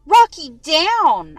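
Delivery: slow, loud stern order to the dog; firm and slow, make the dog obey; stern command. A narrator's reading. Owner ordering the jumping dog down, stern and slow. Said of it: "I say sternly".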